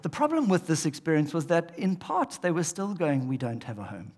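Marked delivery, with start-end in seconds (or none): 3.3-4.1 low, soft, mildly dejected quote; imitated lament. People in booths lamenting their homelessness, subdued. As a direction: voice their quiet, low complaint.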